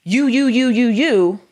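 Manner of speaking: high, loud mocking jab of you; mock accusing you. Wife mocks her husband's accusing tone, high and loud.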